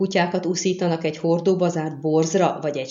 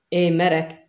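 ordinary delivery

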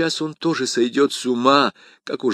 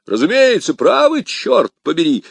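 loud, high hearty outburst; D'Artagnan shouting his agreement, loud and high; exclaiming; 7 semitones higher, 7 dB louder than usual. A narrator's reading. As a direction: burst out with soldierly gusto. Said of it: "вскричал Д'Артаньян".

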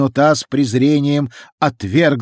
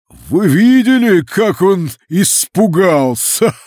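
high, slow, louder mocking voice; gloating creature voice; 7 semitones higher, 6 dB louder, slower than usual. A narrator's reading. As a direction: crow over the scared human. Fountain creature mocks Barber.

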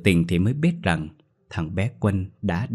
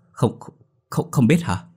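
hoảng hốt; 4 semitones higher than usual. A narrator's reading. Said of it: "trố mắt hoảng sợ".

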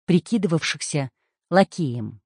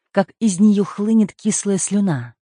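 ordinary delivery, nothing marked

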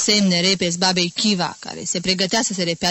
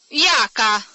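harsh irony; 4 semitones higher, 4 dB louder than usual. A narrator's reading. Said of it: "cu aceeași asprime sentențioasă și ironică".

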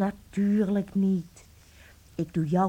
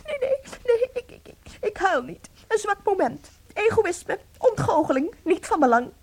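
choked, tearful denial; crying; 10 semitones higher than usual. Acted. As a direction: fight back the sobs while insisting you're fine. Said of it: "Huil nou niet". (huilend, met overslaande stem)

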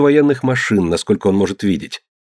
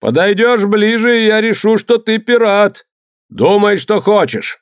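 threatening captain; 10 semitones higher than usual. A narrator's reading. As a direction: threaten across the water.

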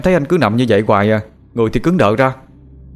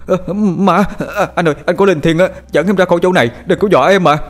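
high, loud, startled yelling; frightened cry; 9 semitones higher, 4 dB louder than usual. Acted. Younger brother fearing his brother's ghost, crying out. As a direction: cry out in panic, pleading. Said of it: "rồi la lên".

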